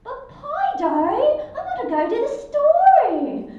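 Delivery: high, loud, stretched Australian cartoon voice; imitated Australian character. Cartoon actor mimicking the Australian track, loud Australian accent. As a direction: whine it big in a bad Australian accent.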